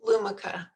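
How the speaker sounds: Lumika with a short, weak middle vowel; correcting pronunciation. Shown as a demonstration. Presenter correcting the host on the name: Lumika as LOO-muh-kuh. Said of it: "Is that how I say it?".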